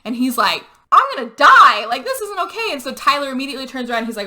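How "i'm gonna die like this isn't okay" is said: high, loud mock-terrified protest; acted panic. Holt retold facing sparking wires, in loud panic. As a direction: wail it in exaggerated alarm.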